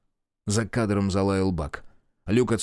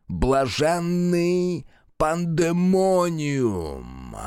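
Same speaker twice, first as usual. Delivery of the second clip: медленное демоническое восклицание; растянуто, с наслаждением; демон; 7 semitones higher, much slower than usual. A narrator's reading. (демоническое восклицание)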